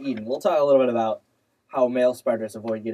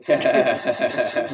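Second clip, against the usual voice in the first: laughing; 3 dB louder than usual. Acted. Laughing mock pronunciation.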